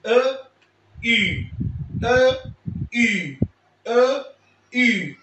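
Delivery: teacher drilling ا against اي; contrast a and u. Shown as a demonstration. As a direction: alternate ا open and اي rounded. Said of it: "الاختلاف هيبقى بس في النطق".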